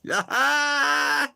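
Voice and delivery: cackling yell; laughing shout. Real, not acted. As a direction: howl with laughter. Laughing hard at the joke.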